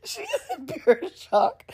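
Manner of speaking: can barely get it out for laughing; real breathless laughter. Real, not acted. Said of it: "I'm sitting here crying".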